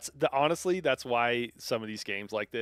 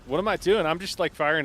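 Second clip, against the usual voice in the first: alarm shout; 7 semitones higher, 9 dB louder than usual. Real, not acted. Laughing at own frenzy.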